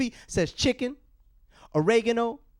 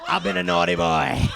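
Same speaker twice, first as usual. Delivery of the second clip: much lower, laughing, sultry confession; seductive confession; 4 dB louder than usual. Pretend. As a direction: ham up the naughty flirt. Phone-sex bit to collector.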